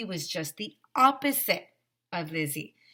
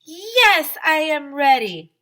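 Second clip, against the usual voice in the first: high, loud, eager yell; a big classroom chant; loud cheer; 10 semitones higher, 14 dB louder, slower than usual. Shown as a demonstration. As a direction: shout it so the whole class joins in. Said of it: "Let's yell louder".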